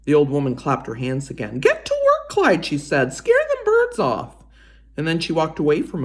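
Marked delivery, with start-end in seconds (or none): 1.6-2.7 put-on much higher, louder old lady voice; old woman voice. Old woman urging her rabbit scarecrow, high and loud. 3.1-4.4 put-on much higher, louder old lady voice; old woman voice. Old woman urging her rabbit scarecrow, high and loud.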